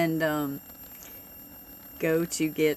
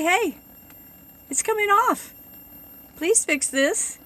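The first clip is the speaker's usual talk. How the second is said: much higher, a mock-nice customer voice; retelling her polite request in a raised sweet voice; 12 semitones higher than usual. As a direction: put on a lightly sugary, asking-nicely voice. Retold polite complaint at shop.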